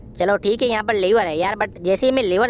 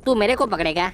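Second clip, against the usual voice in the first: ordinary delivery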